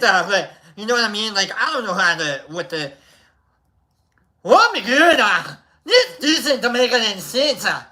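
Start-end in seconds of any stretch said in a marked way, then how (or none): 4.4-7.6 a hint of a bad Italian accent, higher and louder; shitty Italian accent. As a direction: panic like a tripping scientist. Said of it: "a really shitty Italian accent".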